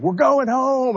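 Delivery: high, joyful holler to the crew; retold holler. Commander telling crew they're heading home, high excited holler. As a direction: shout it out like the good news just landed. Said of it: "I hollered".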